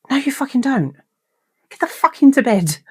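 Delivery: high, exasperated telling-off; exasperated order. Retold or imitated. Woman ordering sleep-talking partner back to bed, exasperated. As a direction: order him back, at the end of your patience.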